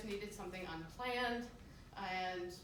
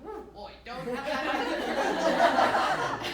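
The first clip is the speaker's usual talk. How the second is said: drawn-out laughing admission; 5 semitones higher, 13 dB louder, much slower than usual. Retold or imitated. Caught out by border officer.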